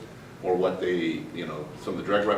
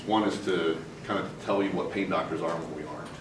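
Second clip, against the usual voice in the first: ordinary talk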